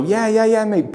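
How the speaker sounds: high, friendly yeah yeah mate; breezy friendly dismissal; very friendly brush-off. Shown as a demonstration. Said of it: "but very friendly".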